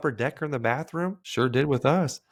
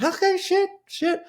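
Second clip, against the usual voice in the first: put-on pained kid; 3 dB louder, slower than usual. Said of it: "And I'm like".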